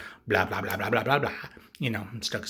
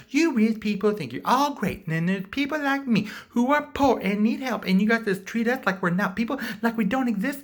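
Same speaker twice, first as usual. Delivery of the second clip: high, loud aggrieved rant; hammed-up victim speech; mock grievance; 12 semitones higher, 6 dB louder than usual. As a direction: complain bitterly in a put-on voice. Acts out Joker's rant.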